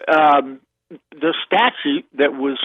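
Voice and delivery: ordinary delivery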